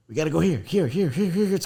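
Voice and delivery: harried brain hurrying you along; frantic inner voice. Acted. Host voicing the restless brain, frantic and high-pitched. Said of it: "in my brain, it's like".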